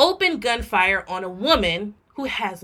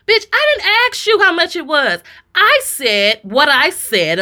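higher and louder, exasperated; heated rant; 8 semitones higher, 8 dB louder than usual. Retold or imitated. Ranting about the Pizza Hut cashier, loud and exasperated. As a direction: blow up at the cashier.

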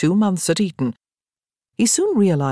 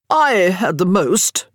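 slow, louder proud brag; drunken boast; 3 semitones higher, 4 dB louder, slower than usual. A narrator's reading. Dunwoody bragging to friends about drinking the most. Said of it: "Dunwoody boasted".

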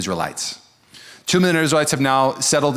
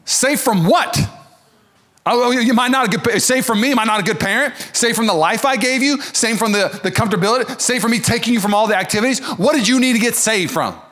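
offended parent; 7 semitones higher than usual. Retold or imitated. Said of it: "the parent would be like".

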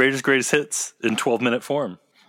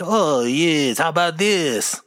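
hammed-up French accent, pitched higher; eager actor tries a goofy French accent; over-the-top French accent; 7 semitones higher, 4 dB louder, slower than usual. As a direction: show off a silly French accent. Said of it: "can you do a French accent".

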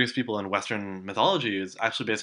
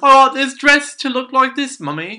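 much higher, louder, whiny brat; spoiled child voice; 13 semitones higher, 12 dB louder than usual. Pretend. Mocking a preppy girl whining to her mummy, a high loud bratty voice. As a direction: whine at mummy like a spoiled kid.

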